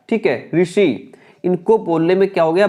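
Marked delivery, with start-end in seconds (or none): none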